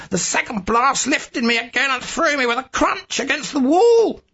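high, urgent narration building to a shout; frenzied climax. A narrator's reading. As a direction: pitch soaring as the blasts hit. Frenzied climax as explosion hits.

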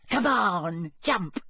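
high, teasing dare; malicious taunt. A narrator's reading. Mermaid daring Harry to reach his broom, teasing. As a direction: goad him playfully but meanly. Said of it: "she giggled maliciously".

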